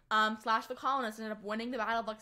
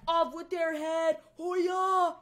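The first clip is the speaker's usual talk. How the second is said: slow, hammed-up royal decree, louder and higher; mock tyrant king; 6 semitones higher, 5 dB louder, much slower than usual. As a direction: play a cartoon king ordering executions. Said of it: "one didn't be like".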